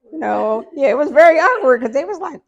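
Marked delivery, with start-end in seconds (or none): none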